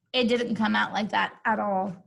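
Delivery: ordinary delivery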